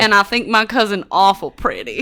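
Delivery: put-on Southern accent; mock Southern accent.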